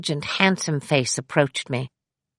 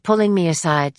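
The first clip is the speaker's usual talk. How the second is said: usual speaking voice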